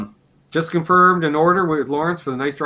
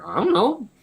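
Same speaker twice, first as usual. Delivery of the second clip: clueless shrug; 8 semitones higher than usual. Retold or imitated. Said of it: "I'm like".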